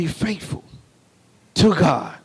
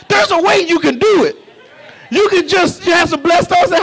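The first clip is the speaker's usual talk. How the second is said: higher, calling out to the congregation; rally the church with every line; fired-up preaching; 7 semitones higher than usual. Acted.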